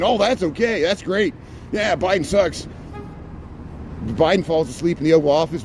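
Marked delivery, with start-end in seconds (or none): 0.0-2.7 sarcastic fan voice. Retold or imitated. Mimicking approving followers.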